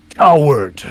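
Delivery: loud one-word accusation from a Goliath; accusing shout. Acted. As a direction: hurl it loudly at a kneeling foe. Game master voices accusing Goliath.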